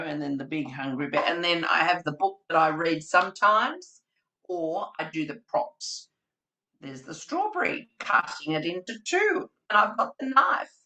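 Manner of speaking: usual speaking voice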